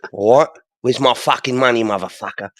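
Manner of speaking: lightly voiced Cockney tough guy; mock Cockney threat, half-hearted; Cockney accent. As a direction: play a London heavy picking a fight. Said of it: "That's a bit cockney".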